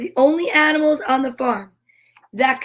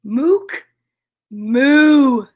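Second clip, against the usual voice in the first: short rising misfire, then louder moo; cow trying again; 4 dB louder than usual. Acted. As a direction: clip a wrong moo, then stretch the right one. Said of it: "And again".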